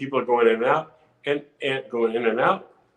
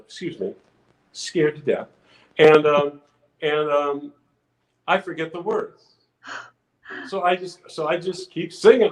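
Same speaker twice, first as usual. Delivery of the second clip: normal talking voice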